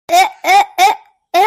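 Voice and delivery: put-on giggly drunken cries; tipsy laughing. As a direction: laugh as if dizzy. Drunk woman reeling.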